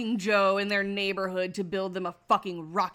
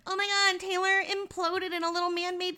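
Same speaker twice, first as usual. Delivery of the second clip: mocking; 8 semitones higher than usual. Mocking a lame death announcement.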